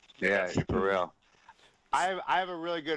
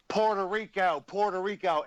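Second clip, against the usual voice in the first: lightly voiced, high repeated catchphrase; a hint of the old wrestler, repeating it; mock wrestler catchphrase, slightly raised; Honky Tonk Man impression; 6 semitones higher than usual. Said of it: "I nailed that fucking impression".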